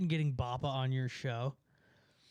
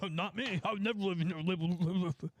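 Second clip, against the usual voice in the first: mock snooty refusal; 3 semitones higher than usual. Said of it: "Would you rather live".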